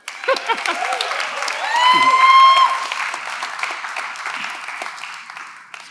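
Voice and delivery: high breathy burst of real laughter; long laugh. Real, not acted. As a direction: laugh hard at your own act. Laughing at his own impression.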